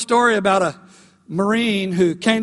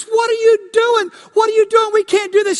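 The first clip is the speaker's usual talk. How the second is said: high, loud alarmed shouting; panicked friend shouting at the Marine; shocked yell; 12 semitones higher, 5 dB louder than usual. Retold or imitated. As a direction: yell at him in disbelief. Shocked friend in retold bar fight.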